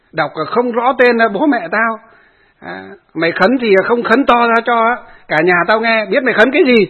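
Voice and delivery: loud, angry scolding of the monk; mock furious son yelling; retold angry cursing. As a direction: bark accusations at the monk. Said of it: "Cậu con trai nó lại càng chửi tiếp".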